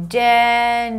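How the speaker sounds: "January" stretched out slowly, every syllable held. Shown as a demonstration. Teacher drilling January, syllable by syllable. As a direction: draw out each syllable of "January" on a level tone.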